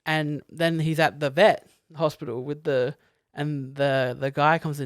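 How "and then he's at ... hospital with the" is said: ordinary talk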